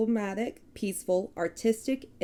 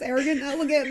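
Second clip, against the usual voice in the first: "arrogant and elegant", high and laughing, loose Texan vowels; usual Texan accent; 6 semitones higher, much faster than usual. Shown as a demonstration. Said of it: "'Cause normally I say it like this".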